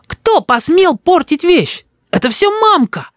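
put-on very high, outraged boy voice; child's yell. A narrator's reading. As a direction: accuse in a piercing child's cry. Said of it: "закричал Рис".

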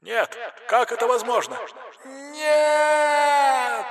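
very high, frightened inhuman cry; eldritch horror. A narrator's reading. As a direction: screech of a terrified monster. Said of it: "испуганный, нечеловеческий голос".

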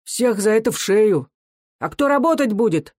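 high-pitched, heated outcry; agitated crowd voices. A narrator's reading. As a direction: throw it out excitedly from the crowd. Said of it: "Возбуждённо запрыгали слова".

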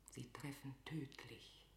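whispered death wish for her rival; deadly whisper. Acted. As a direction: breathe the threat like a secret. Gloating alone over execution order.

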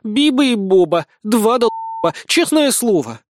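high, loud despairing scolding; exasperated. Acted. Blonde berates her two comrades, exasperated. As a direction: scold them as hopeless fools. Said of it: "обречённо вздохнула".

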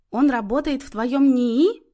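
slightly tense. A narrator's reading. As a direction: probe carefully, a little on edge. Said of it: "немного напряглась мать".

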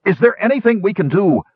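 anxious shouted offer of help; calling out. A narrator's reading. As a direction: call a question down to someone far away. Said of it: "Sturm called".

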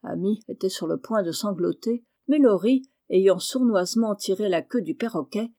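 ordinary delivery, nothing marked

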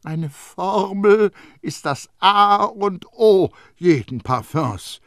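high, loud, slow and stern. A narrator's reading. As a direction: lay down the law to the boy. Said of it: "erwiderte Baldini streng".

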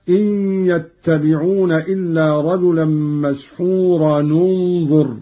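مسحورا linked to انظر with u, "masḥūranu-nẓur"; damma when joining. Shown as a demonstration. Teacher giving a further example, مسحورا انظر joined with damma. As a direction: carry the tanween of مسحورا into انظر as nu. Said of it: "وكذا قوله تعالى".

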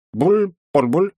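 high, slow gurgling cry through water; shouting underwater. Acted. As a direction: gurgle the words like a drowning shout. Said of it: "у привидения не получается говорить под водой".

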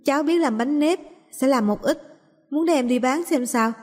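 put-on high, eager child's voice; little girl offering rice cakes. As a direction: pitch it up like a hopeful little girl.

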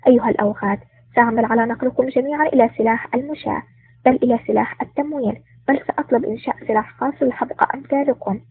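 plain reading voice

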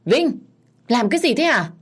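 alarmed shout while approaching; calling out loudly. A narrator's reading. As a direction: call sharply to stop someone. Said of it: "vừa đi lại vừa nói lớn".

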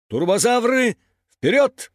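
high, loud command; rally the team into action; rallying call. A narrator's reading. Командир зовёт команду в путь. (призывный командный клич)

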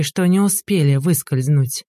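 ordinary delivery, nothing marked

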